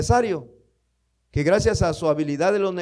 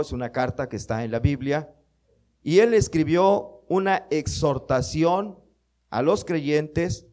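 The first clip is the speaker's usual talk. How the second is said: ordinary talk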